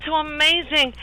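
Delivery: high-pitched wonder; amazed delight. Acted. [name] touches the friend's face, amazed. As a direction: marvel at it in a high voice.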